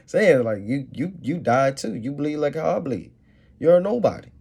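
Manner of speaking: taunting a fallen ruler, mocking and loud. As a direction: rub it in his face. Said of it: "It's mockery".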